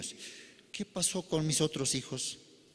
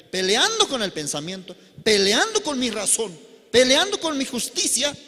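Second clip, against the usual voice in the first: louder, rising sermon build; fervent preaching; 7 semitones higher, 6 dB louder than usual. Acted. Preacher urging the faithful to fight the flesh, louder and building. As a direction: hammer each "peleando" to the crowd.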